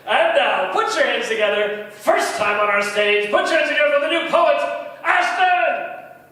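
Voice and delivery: loud rising emcee build to the name; grand entrance announcement. Acted. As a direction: hype up the crowd for the next act. Emcee brings on new poet.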